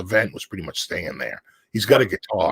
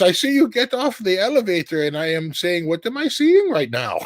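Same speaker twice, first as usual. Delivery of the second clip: amazed fan; 11 semitones higher than usual. Retold or imitated. German fan retelling his shock.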